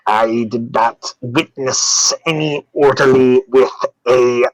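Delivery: very slow, drawn-out doctor character voice; ponderous, deliberate figure insisting on innocence; exasperated doctor. Acted. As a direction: spell out the denial word by laboured word.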